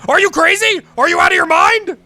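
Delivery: hammed-up shout at the editor; mock outraged shouting. Real, not acted.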